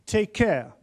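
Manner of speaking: normal talking voice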